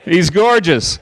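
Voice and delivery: loud, high gushing sigh; hammed-up swooning praise; mock swoon. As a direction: gush like a smitten fan. Swooning over the suitor.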